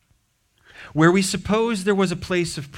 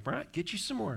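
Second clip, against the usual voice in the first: high, soft insistent grandma voice; lightly voiced Southern grandma pressing food; 9 semitones higher, 13 dB quieter than usual. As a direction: playfully mimic a pushy grandmother offering seconds. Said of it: "Ginger's grandma was that way".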